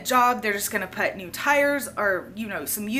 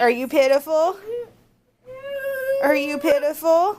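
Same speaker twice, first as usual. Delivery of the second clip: mock pity; 7 semitones higher, 5 dB louder than usual. Real, not acted. Teases her whining child.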